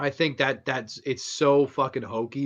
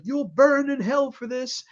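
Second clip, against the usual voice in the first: higher, louder imitated preacher's threat; hammed-up priest; 13 semitones higher, 5 dB louder than usual. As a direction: lightly act a priest damning someone. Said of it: "he's just like".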